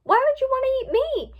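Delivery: high, loud frantic question; panicked shout. A narrator's reading. Twilight to cannibal Pinkie approaching her, panicked shout. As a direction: plead loudly at the top of your range. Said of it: "Twilight shouted".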